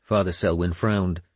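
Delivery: ordinary delivery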